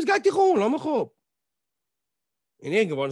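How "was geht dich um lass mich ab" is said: indignant snap. Retold or imitated. Esther weist den König schroff ab.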